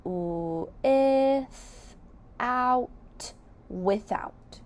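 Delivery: "With, out" in two separate beats, then "without" joined; syllable by syllable. Shown as a demonstration. Teacher blending a compound word for the class, beat by beat. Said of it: "Let's sound out without all together".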